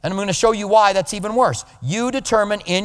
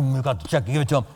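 grudging mutter; 5 semitones lower, 4 dB quieter, faster than usual. Retold or imitated. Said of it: "you kinda go".

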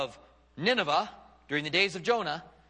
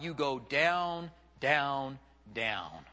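stepping downward; 4 semitones lower, much slower than usual. Shown as a demonstration. Pitch steps down to illustrate descending.

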